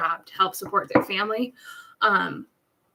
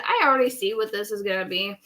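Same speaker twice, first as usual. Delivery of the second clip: ordinary talk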